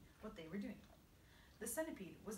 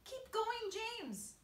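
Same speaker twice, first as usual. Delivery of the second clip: high-pitched urging cheer; crying out; 14 semitones higher, 5 dB louder, much slower than usual. A narrator's reading. Creatures cheering James on, crying out. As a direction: urge him on with a high cry. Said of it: "they cried".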